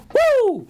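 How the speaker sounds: high, loud wrestler's woo; Ric Flair woo. Pretend. Stock host cheers viewers on with a wrestler's woo. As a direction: let out a piercing Ric Flair whoop. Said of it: "Ric Flair in the building".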